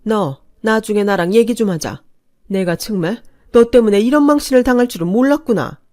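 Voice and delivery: bitter humiliated anger; mother-in-law threatening a later talk; angry voice. Acted. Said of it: "화가 난 목소리로".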